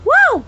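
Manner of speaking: sudden high yelp of "wow"; involuntary high outburst; vocal tic wow. Real, not acted. Her tics returning as she relives it, yelped wow. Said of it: "Wows were coming out".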